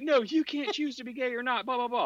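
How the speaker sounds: high-pitched dismissive imitation; mock objecting woman. Quoting the woman's objection in debate, high mocking voice. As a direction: mimic her protest, pitched far up, tailing into blah-blah. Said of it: "And she's like".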